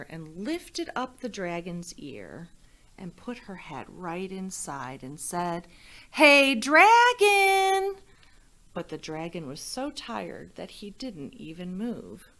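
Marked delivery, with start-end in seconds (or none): 6.1-8.0 very loud, high, slow yell; shout into his ear. Acted. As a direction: bellow it right in his ear. Shouting into sleeping dragon's ear.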